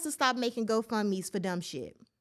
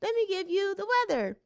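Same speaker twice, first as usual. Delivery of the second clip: high, bright mock announcer voice; playful imitation of a perky news intro; mock newscaster intro; 8 semitones higher than usual. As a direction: hype it up like a cheesy weather host. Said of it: "They told me to say".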